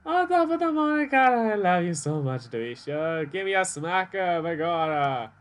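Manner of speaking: the player hamming up a lovesick Irish McMurphy, loud and high; mock Irish accent. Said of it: "That is so offensive".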